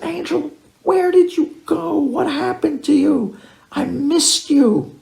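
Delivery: high, eager questions slowing on the last line; overjoyed reunion. Retold or imitated. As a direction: pour out joy and relief at finding him. Rabbi greeting the lost boy.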